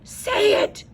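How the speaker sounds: high, sharp impatient hiss; a bossy little girl hissing a prompt; hissing angel. A narrator's reading. An angel hisses the line at Frances, impatient. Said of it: "hissed an angel".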